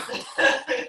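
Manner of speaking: breathy high giggle; flustered laugh at the praise; embarrassed laugh. Real, not acted.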